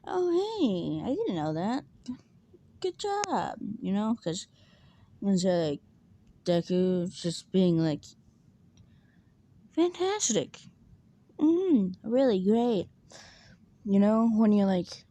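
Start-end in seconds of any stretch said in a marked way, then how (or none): 0.1-3.6 fake cheerful. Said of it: "He's like". 9.7-12.9 high, sing-song hollow praise up; bored fake praise. Rattling off hollow praise to a dull cousin, high and bored. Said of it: "run out of actual good responses".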